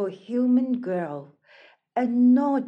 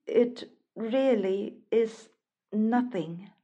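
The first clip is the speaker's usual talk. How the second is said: usual speaking voice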